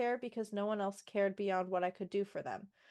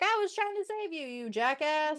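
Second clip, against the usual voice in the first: higher mock shout of an angry heroine; hammed-up angry retort, much higher; mock yell; 5 semitones higher than usual. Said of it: "they go into a screaming match".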